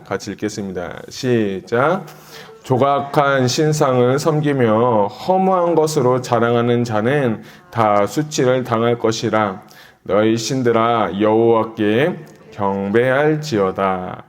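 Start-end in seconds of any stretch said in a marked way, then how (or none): 2.6-14.2 loud, slow, measured Bible reading; scripture reading aloud. Real, not acted. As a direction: lead the congregation through the verse, loud and drawn-out. (크고 느리게 또박또박 성경 봉독)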